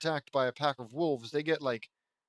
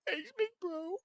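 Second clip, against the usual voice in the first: very high, tiny put-on voice; little sister voice. [name] thanking her big brother, tiny high voice. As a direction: squeak out a small thank-you.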